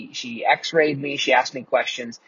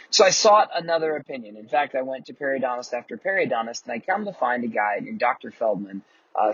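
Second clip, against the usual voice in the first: ordinary talk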